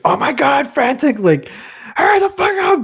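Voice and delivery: panicked hurry-up; hammed-up frantic panic, high-pitched; mock frantic.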